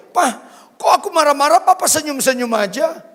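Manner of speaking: high, loud exasperated wife; wife protesting to her husband; complaining wife. Retold or imitated. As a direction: whine it like an annoyed wife. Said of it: "selalu berakhir".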